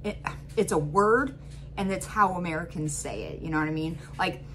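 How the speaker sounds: usual speaking voice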